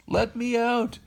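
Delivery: high-pitched plea to be let out; desperate plea. Acted. Pinocchio pleads from inside the cage, high and urgent. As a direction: beg to get out, voice up high.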